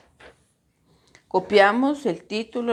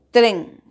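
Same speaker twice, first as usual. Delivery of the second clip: TREN punched loud in one beat; stressed syllable; 7 dB louder than usual. Shown as a demonstration. Teacher showing where the voice has most force, stress on TREN. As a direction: hit tren hard as a single falling syllable. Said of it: "dónde va a tener la mayor fuerza".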